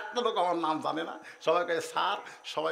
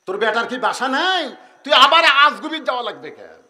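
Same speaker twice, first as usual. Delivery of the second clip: indignant inner voice; 6 semitones higher, 11 dB louder than usual. Acted. Inner voice scolding the father.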